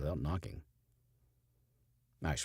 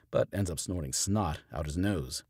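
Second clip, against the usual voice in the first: plain reading voice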